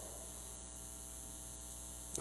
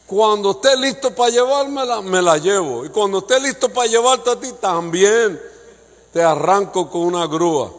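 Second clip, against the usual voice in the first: higher, louder stern divine voice; forceful preaching; 6 semitones higher, 7 dB louder than usual. Acted. Pastor voicing God's firm answer, loud preaching. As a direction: declare it like God settling the matter.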